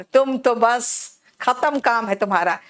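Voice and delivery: ordinary talk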